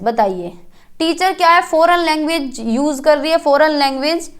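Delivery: usual speaking voice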